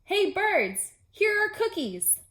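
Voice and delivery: high, loud call to the birds; loud shout. Acted. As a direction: call them from far across the yard. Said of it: "shouted in a loud voice".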